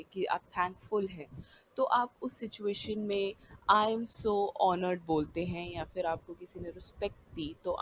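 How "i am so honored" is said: "I am so honored" said very slowly; slow model phrase. Shown as a demonstration. Teacher slowly modelling thanks for an award. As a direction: draw out each word of honored. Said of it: "आप response में बोलते हैं".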